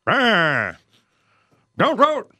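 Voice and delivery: put-on high, cranky old-timer voice; old person voice. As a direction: grumble like a grouchy old man. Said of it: "That was an old person impression".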